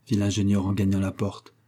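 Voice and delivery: usual speaking voice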